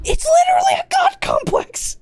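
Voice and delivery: shrieked way up and loud; "literally" screamed in a high loud burst; high shout. Real, not acted. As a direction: yell it to top the argument. Shouting the earlier joke.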